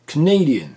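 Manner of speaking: Canadian punched high and loud on NAY; second-syllable stress. Shown as a demonstration. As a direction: hit NAY in Canadian, weak first syllable. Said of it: "mais sur la deuxième".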